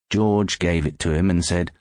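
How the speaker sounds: ordinary delivery, nothing marked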